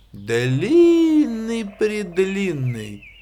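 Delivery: long-drawn "длинный-предлинный". A narrator's reading. Showing a long tail. (протяжно «длинный-предлинный»)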